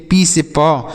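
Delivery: normal talking voice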